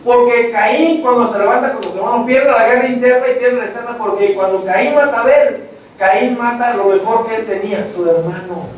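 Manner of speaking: ordinary talk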